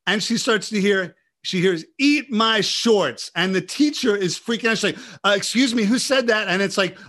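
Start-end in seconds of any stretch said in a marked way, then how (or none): 2.0-3.3 higher, louder, slow taunt; imitating a teen rebel; movie punk taunt. Movie line heard in classroom. 5.2-6.5 higher, louder startled question; imitating a startled teacher; alarmed teacher. Teacher freaking out.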